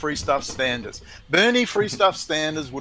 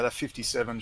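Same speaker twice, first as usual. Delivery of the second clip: ordinary delivery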